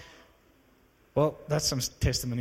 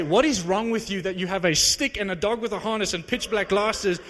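louder, higher, baffled rant at the man; mock indignant shout, higher and louder; exasperated outburst; 5 semitones higher, 5 dB louder than usual. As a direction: let it burst out in disbelief. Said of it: "I wanted to say".

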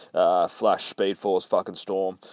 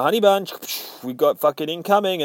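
high, louder mock alarm; higher-pitched comic warning call; excited Harley warning; 7 semitones higher, 4 dB louder, faster than usual. Harley warns of incoming trouble, high mock alarm.

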